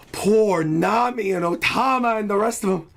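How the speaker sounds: ordinary talk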